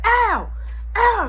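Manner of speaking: high, loud yelp; mock yell. Mom crying out in the hall, high and loud. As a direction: yelp out sharply.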